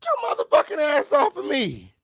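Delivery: high, yelled protest; shouted resisting arrest. Pretend. Pretending to fight off the arresting cops, shouting high.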